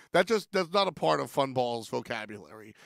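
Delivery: ordinary delivery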